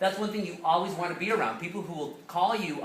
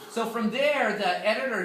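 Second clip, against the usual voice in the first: usual speaking voice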